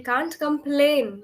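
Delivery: "complain" said slowly, ending on a held n with no t sound; careful pronunciation. Shown as a demonstration. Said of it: "not complaint".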